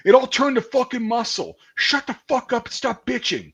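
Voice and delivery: high, loud scornful drill sergeant; drill sergeant yelling. Retold or imitated. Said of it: "He was like".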